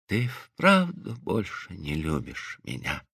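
slow, very soft and low; weak, weary murmur. A narrator's reading. Wounded wife asks her husband about love, weak and weary. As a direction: ask faintly, with little strength left.

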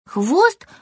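high squirrel voice, lecturing; a small squirrel defending his tail; little squirrel, a bit cross. Acted. Squirrel figure held voice.

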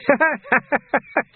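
high gleeful laugh; villain's cackle. A narrator's reading. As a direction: cackle in triumph. Carcer laughs.

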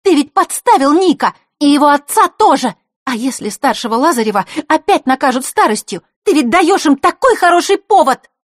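fiery outraged shout; heated. A narrator's reading. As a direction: blow up at him. Girl keeps scolding the runaway.

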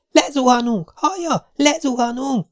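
very high, frantic terror; hysterical panic. A narrator's reading. Jessica shrieks hysterically at Spider as they fall. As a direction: shake him and shriek.

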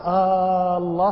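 stretched, level آه leading into الله; question-form long alef. Shown as a demonstration. Teacher shows students the question form, alef drawn out. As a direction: hold آه long before الله. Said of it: "هذا سؤال".

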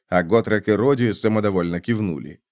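ordinary delivery

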